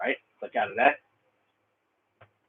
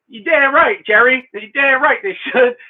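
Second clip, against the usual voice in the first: higher, louder, hearty agreement; emphatic yes; 7 semitones higher, 4 dB louder than usual. Real, not acted. Answers viewer's question emphatically.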